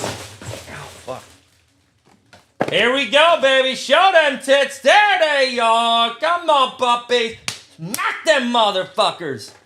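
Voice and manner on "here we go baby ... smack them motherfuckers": high, loud hype chant at the pack; excited shouting. Real, not acted. Streamer hyping the rip for his viewers, loud and high. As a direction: cheer the cards on, way up and loud. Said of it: "I'm getting excited".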